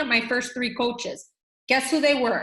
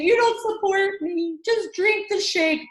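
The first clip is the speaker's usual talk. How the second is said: dramatic; 7 semitones higher, slower than usual. Pretend. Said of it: "we can get dramatic".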